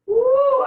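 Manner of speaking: high, loud, held "woo"; piercing drawn-out whoop. Real, not acted. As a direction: hoot at the sting of the onion. Reacting to onion fumes.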